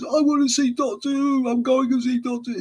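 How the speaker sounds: very high, insistent mock Somerset voice; attempted Somerset accent. In-character passenger insisting to the driver, high mock Somerset accent. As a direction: plead in a hammed-up Somerset accent. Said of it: "Somerset accent, I think it was".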